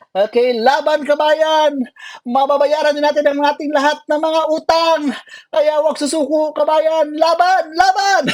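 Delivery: high, loud rallying yell; rally the runners, full voice; cheering shout. Real, not acted. Cheering fellow Filipinos at finish.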